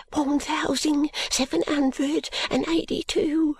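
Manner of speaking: put-on high, dazed servant-girl murmur; high, timid maid voice. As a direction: mumble the number as if in a trance. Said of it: "unaccountably to murmur".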